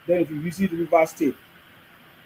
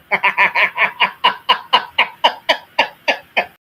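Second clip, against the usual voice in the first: loud laughter; 3 semitones higher, 5 dB louder than usual. Real, not acted. Mocking tribe complainers.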